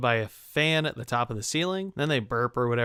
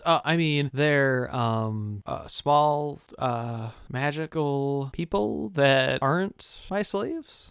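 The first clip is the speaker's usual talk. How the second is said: halting, slow search for each word; hesitant backtracking; much slower than usual. Acted. Willy Wonka covering up calling them slaves, hesitant. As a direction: fumble through a bad cover-up, drawn out. Covering up a slip, groping for words.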